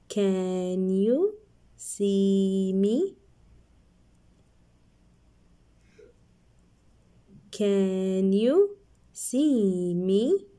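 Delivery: very slow, stretched question; slow reading. Shown as a demonstration. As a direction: drag each word out. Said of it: "So let's read".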